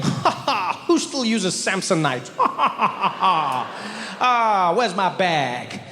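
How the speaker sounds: hammed-up smug cackle at the baggage carousel; mocking laugh. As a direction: laugh down at other people's luggage. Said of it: "I was standing there judging them".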